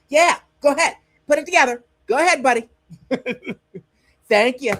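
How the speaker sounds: high, loud mock-encouraging voice; condescending coaxing. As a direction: cheer them on like a toddler. Mocking America coaxing Mexico.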